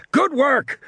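high-pitched shouted praise; shouting. A narrator's reading. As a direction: cheer it out. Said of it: "Muldoon shouted".

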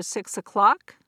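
"six o'clock" rising at the end like a question; uptalk seeking approval. Shown as a demonstration. Lecturer quoting the dinner answer, questioning rise on "o'clock". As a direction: lift "o'clock" as if asking for approval. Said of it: "can sound like a request for approval".

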